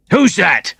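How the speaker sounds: high, loud call into the dark; shouted question. Acted. A man shouts from the office at the noise outside, high alarmed call. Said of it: "someone shouted".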